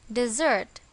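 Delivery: "dessert" with the stress on its second syllable, di-ZERT. Shown as a demonstration. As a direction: lean on the end of "dessert", di-ZERT. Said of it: "On the second syllable".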